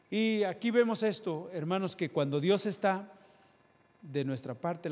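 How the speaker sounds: loud, high preaching burst; fiery pulpit cry. Real, not acted. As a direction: lift the congregation with force. Preacher raising voice.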